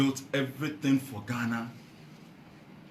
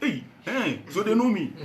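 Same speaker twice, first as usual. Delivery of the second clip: high, delighted exclamation with a laugh; tickled at being noticed; happy; 7 semitones higher than usual. Retold or imitated. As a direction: re-enact pleased surprise, pitched up.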